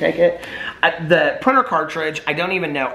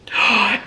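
surprised gasp; 6 semitones higher than usual. Real, not acted. Camera turns back on.

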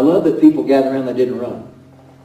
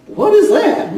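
high, laughing "what is that?"; a spooked, half-laughing gasp of a question; startled thought; 4 dB louder, slower than usual. Retold or imitated. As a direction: jolt up in alarmed surprise. Startled by convulsions at altar.

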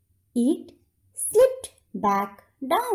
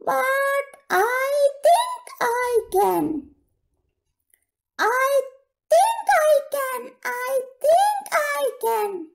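hopeful tiny engine; 13 semitones higher than usual. Acted. The tiny engine encourages itself, high and hopeful. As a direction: say it hopefully like a small brave engine.

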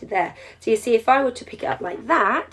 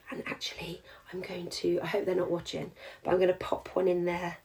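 hushed secret whisper, then barely voiced; 4 semitones lower, 10 dB quieter than usual. Real, not acted. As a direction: keep the present a secret, quietly. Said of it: "I don't know why I'm whispering that".

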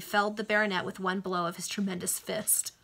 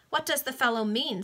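higher-pitched impudent question; 5 semitones higher, 4 dB louder than usual. A narrator's reading. Chichester feigns not knowing the man, impudent. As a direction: ask it with mild insolence. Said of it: "said Chichester in an impudent tone".

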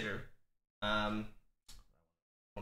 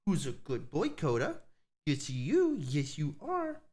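puppy talk; 3 semitones higher than usual. Real, not acted. Cooing to new puppy.

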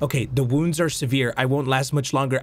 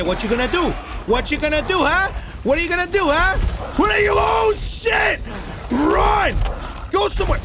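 rising panicked shout; 13 semitones higher, 3 dB louder than usual. Real, not acted. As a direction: taunt that climbs into a scream. Taunting monster, then it charges.

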